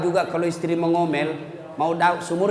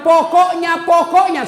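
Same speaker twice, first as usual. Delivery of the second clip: loud, high, stubborn repeat; loud high stubborn wife's insistence; insistent nagging; 9 semitones higher, 8 dB louder, slower than usual. Retold or imitated. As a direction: hammer it like a stubborn nag. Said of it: "kalau Nabi bilang".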